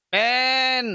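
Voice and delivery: मैन said as English "man" with the open a vowel; pronunciation demo. Shown as a demonstration. Teacher modelling मैन for students, careful pronunciation. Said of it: "अब उच्चारण कैसे करेंगे".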